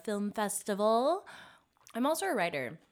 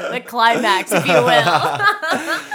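sultry tease; 12 dB louder, slower than usual. Acted. Teasing sexy aside.

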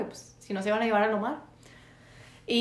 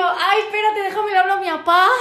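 flustered; 8 semitones higher, 7 dB louder than usual. Retold or imitated. Flustered reply to policeman.

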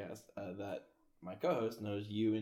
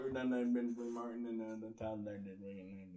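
rambling Texas South accent, fading away; Texas Southern accent; 3 dB quieter than usual. Shown as a demonstration. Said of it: "It's like some Texas South".

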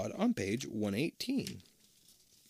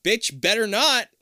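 slow, high, loud sassy warning; 10 semitones higher, 7 dB louder than usual. Acted. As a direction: drag it out like a threat. Warning friend in story.

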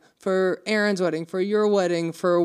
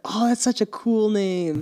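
slow, higher retold admiration; admiring reaction; 5 semitones higher, slower than usual. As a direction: gush a little, stretching the words. Retelling admiring reaction to name.

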